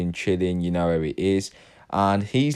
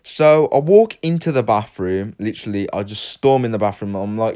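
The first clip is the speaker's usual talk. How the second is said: ordinary talk, nothing marked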